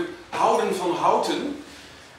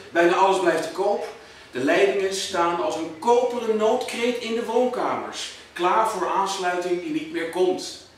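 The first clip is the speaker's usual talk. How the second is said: higher, punchy stage verse; emphatic poem recital; 5 semitones higher, 4 dB louder than usual. Acted. Poet leading the audience through the new suburb, emphatic recital. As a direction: drive the rhythm of the verse out to the room.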